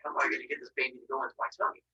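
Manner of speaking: soft, fast plotting; scheming mutter. Acted. Father plotting to eat the baby, soft and fast. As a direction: mutter the plan under breath.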